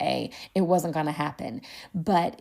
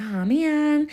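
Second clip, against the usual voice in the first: slightly disappointed; 9 semitones higher, 5 dB louder than usual. Retold or imitated. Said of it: "slightly disappointed".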